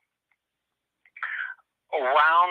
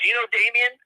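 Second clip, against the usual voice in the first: high-pitched imitation of a Southern caller; Southern accent; 10 semitones higher than usual. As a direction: ask it like a Southerner, voice lifted. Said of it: "guy with a thick Southern accent".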